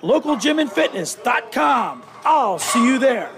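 upbeat live radio ad read; hard sell ad. Acted. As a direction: pitch the gym like a spot. Host reads gym ad.

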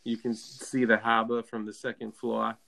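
Boston accent. Shown as a demonstration. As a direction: demo a Boston accent, dropping the r's. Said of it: "like Boston".

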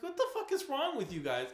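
high-pitched incredulous outburst; what-the-hell yelp; mock outrage. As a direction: squeal it in disbelief at the NPCs. Exasperated at game characters.

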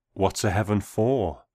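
ordinary delivery, nothing marked

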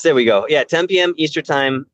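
ordinary talk, nothing marked

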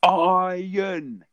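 high, loud single word; talking to a foreigner. Retold or imitated. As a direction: say it loudly to a foreigner. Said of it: "you just go".

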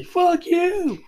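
a loud, high mock "Fuck you"; blunt comeback. To a friend, suggesting what to say next time, loud mock defiance. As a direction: fire off a cheeky insult, half joking. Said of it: "just say".

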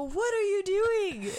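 high, loud and slow, half-laughing; startled outcry. Retold or imitated. Wife catching her husband about to find his gift, loud and startled. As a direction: call out in shock, voice jumping way up.

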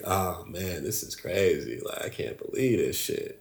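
low, soft, deflated murmur; retold dejection. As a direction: sink into quiet, defeated disbelief. Retold dejection after being robbed.

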